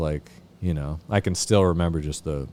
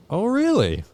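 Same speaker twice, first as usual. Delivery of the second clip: mock surprise; 5 dB louder than usual. Sponsor hears of relapse.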